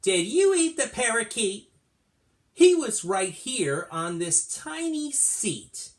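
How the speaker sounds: put-on high, loud accusing little girl; little girl's question. A narrator's reading. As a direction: read it as a girl confronting her cat. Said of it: "and she says".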